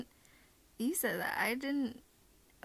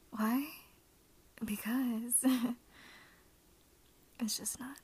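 ASMR whisper. Acted. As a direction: hush it close to the mic. Holds soft ASMR whisper.

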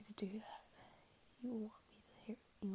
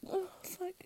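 high loud breathy moan; 6 semitones higher, 10 dB louder, much slower than usual. Acted. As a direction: cry it out high. Climactic moans.

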